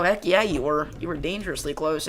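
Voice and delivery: ordinary talk, nothing marked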